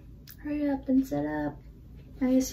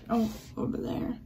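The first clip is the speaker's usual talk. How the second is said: ordinary delivery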